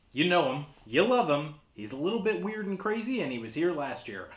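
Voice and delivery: showman guest introduction; horror host. Acted. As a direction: announce the guest with flair.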